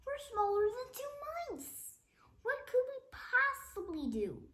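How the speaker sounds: high squeaky little voice; worried kid. Acted. Cheeseball fretting to Fluffy about being tiny. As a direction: sound tiny and panicked.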